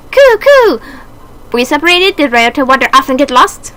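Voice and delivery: high, loud birdlike voice, cooing; a fierce little dove vowing to win; excited pigeon character. Acted. Pigeon Oka-san rallying friends in a high voice.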